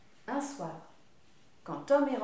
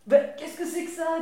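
louder, higher disbelieving question; astonished; 6 semitones higher, 8 dB louder than usual. Acted. Tom astonished to hear about dung balls. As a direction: sound shocked and amazed.

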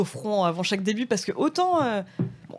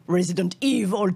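Resident Evil in a put-on English accent; English pronunciation. Shown as a demonstration. Guest showing how the game title is really pronounced, English accent. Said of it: "Je sais que ça se prononce".

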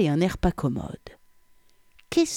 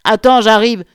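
loud, raised man's shout; calling out; 6 semitones higher, 12 dB louder than usual. A narrator's reading. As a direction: holler down from the street. Colleague shouts down from the street. (lancer fort, de loin)

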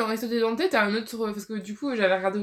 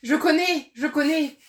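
loud, higher burst of excitement; excited recognition; 6 semitones higher, 6 dB louder than usual. Real, not acted. Player recognizes the opening line read by opponent, excited. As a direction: blurt it out excitedly, voice raised.

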